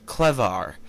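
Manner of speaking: plain reading voice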